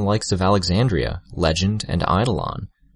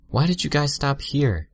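murmured; 9 semitones higher than usual. A narrator's reading. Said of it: "Tattletale murmured to us".

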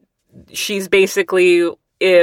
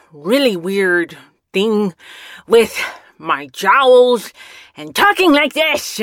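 slow, lightly growled goblin voice; a hint of a goblin, jowls pushed out; 3 semitones higher, 3 dB louder, slower than usual. Shown as a demonstration. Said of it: "my goblin voice".

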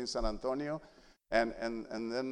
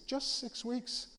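wry aside; 10 semitones higher, 8 dB quieter, much slower than usual. Retold or imitated. Downplaying mother's worry.

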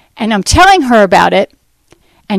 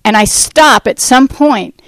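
ordinary talk, nothing marked

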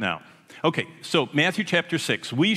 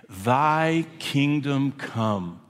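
Thy kingdom come drawn out very slowly, each word weighted; stress on thy kingdom come; much slower than usual. Shown as a demonstration. Preacher stressing the three key words of the prayer for the congregation, very slow. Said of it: "what we're gonna emphasize when we get to it is those three words".

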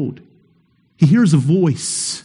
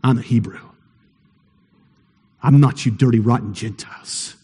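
low, quieter sneer; lightly voiced contemptuous Jonah; scornful Jonah; 8 semitones lower, 5 dB quieter, faster than usual. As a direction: look down on the dirty Gentiles. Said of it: "that's the first thing Jonah had to say".

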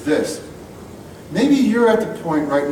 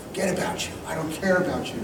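hushed mock parent; 8 dB quieter, faster than usual. Parents rejecting, voiced.

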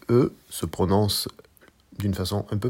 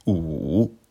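OU dipping low then climbing back up; third tone on OU; 3 semitones lower than usual. Shown as a demonstration. Teacher showing learners Mandarin OU, dipping tone. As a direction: draw OU down and up again. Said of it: "Je répète".

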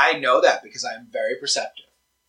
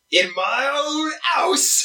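high, slow outraged yell; indignant shout; 12 semitones higher, much slower than usual. Acted. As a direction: bellow it in wounded pride. Dragon offended shout.

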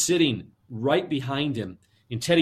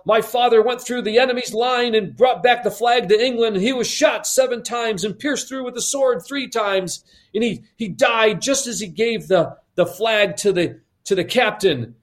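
put-on higher-pitched eager boy bragging; boasting boy; 6 semitones higher than usual. Teddy telling all the kids of his war-hero father, boasting. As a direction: tell it proudly in a raised young voice.

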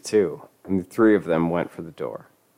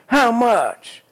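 high shrill demand; 4 dB louder than usual. Acted.

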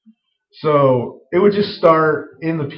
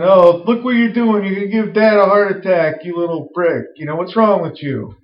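higher mock scolding of a little brother; sibling scolding; 6 semitones higher than usual. Sister chiding Timmy, lightly voiced scolding. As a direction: nag him like a bossy older sibling. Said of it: "the other one".

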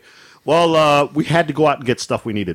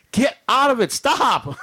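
higher-pitched mock scolding; exasperated wife; 11 semitones higher, much slower than usual. Wife ordering husband out of freezer, mock exasperation. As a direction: imitate a wife at her wits' end.

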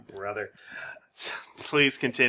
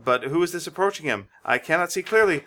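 ordinary talk

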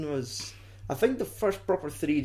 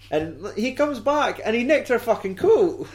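high-pitched laughing disbelief; amused outrage; 9 semitones higher than usual. Real, not acted. Ranting to a friend about the stranded nurse, amused outrage. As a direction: rant incredulously with a laugh in it.

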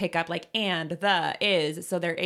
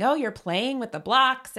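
parentese; 6 semitones higher than usual. Shown as a demonstration. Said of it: "they hear you saying".

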